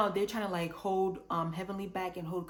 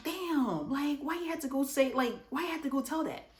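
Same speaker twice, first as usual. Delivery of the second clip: exasperated outburst; 7 semitones higher than usual. Retold or imitated. Quad caught out, exasperated quote.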